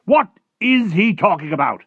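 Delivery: high, loud, indignant outburst; baffled military chief. Acted. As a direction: demand an explanation as a baffled officer. Baffled Air Force chief bursts out.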